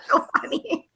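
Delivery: breathy whisper after laughing; whispered aside. Real, not acted. Amused by Brett's mistakes, whispering to herself.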